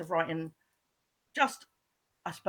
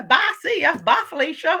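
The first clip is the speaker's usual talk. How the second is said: mock American sendoff; 7 semitones higher, 8 dB louder than usual. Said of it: "as they say in America".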